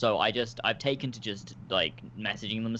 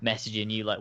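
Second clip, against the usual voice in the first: ordinary talk, nothing marked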